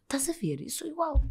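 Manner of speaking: soft gentle whisper; whispered reassurance to a friend. Retold or imitated. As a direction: hush it to her kindly. Gentle reply to relieved friend.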